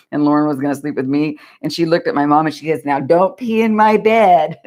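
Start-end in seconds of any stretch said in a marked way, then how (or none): 2.9-4.6 higher, slower child's voice, a playful imitation of a stern kid; little girl's warning. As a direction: imitate a small daughter warning grandma, slow and firm. Daughter warning grandma, retold.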